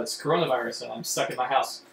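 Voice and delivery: ordinary talk, nothing marked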